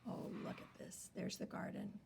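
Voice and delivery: soft whisper of delight; whispered wonder. Real, not acted. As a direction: hush it, marvelling. Whispered aside.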